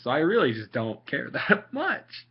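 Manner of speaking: ordinary delivery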